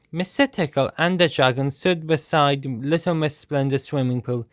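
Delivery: ordinary delivery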